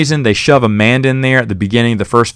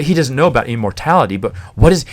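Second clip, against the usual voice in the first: normal talking voice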